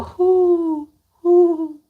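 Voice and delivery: high hooting who who; light owl hoot imitation. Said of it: "that's how they sound".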